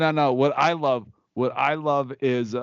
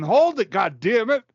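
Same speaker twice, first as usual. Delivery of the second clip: dad snapping from the driver's seat; high, loud angry dad; 8 semitones higher, 4 dB louder than usual. Retold or imitated. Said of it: "My dad, and you know".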